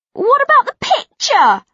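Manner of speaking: put-on high, loud whiny girl; whining. A narrator's reading. Megan whining to Penny about the photo. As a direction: whine it like a spoilt teen. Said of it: "Megan whines".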